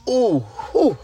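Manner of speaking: high swooping ooh, then a whew; wowed ooh and whew. Real, not acted. Host recalling open mic night, wowed whooping sighs. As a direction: let out high, amazed oohs. Repeats his amazed exclamation.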